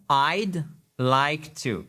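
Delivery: slow, careful I'd like to; teacher modelling I'd like to; careful English. Shown as a demonstration. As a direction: sound out I'd like to gently. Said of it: "Attention à la prononciation".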